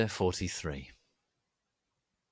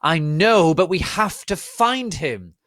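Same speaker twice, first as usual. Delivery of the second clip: higher, louder know-it-all retort; smart-alecky; 10 semitones higher, 12 dB louder, slower than usual. Acted. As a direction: say it like you know best, slowing down.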